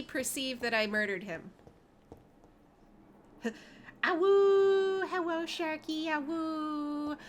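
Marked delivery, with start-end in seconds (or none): 4.0-5.1 high drawn-out wolf howl. Pretend. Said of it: "That's a sound that vampires make". 6.1-7.2 wolf voice. Pretend. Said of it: "That's a sound that vampires make".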